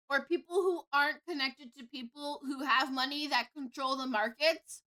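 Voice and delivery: usual speaking voice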